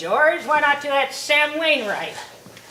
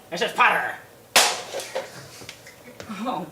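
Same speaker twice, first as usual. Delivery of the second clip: ordinary talk, nothing marked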